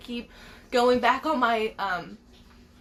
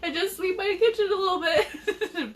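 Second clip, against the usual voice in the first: laughing through the words, higher; giggling sheepishly at herself; half-laughed admission; 9 semitones higher than usual. Real, not acted. Laughing at her own scolding.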